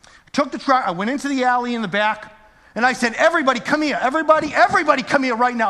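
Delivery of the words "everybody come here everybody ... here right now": higher, louder urgent calling of the staff; mad summons. Retold or imitated. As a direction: call everyone over now, fired up. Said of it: "I was mad".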